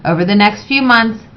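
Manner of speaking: usual speaking voice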